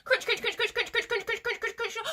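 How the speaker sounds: very fast, high crunching; running away quickly. Shown as a demonstration. Running away from the Gruffalo, frantic quick crunching. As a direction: gabble each crunch like scampering feet. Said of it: "We need to run away very quickly".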